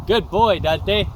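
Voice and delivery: loud, high praise to a dog; calling the dog. Real, not acted.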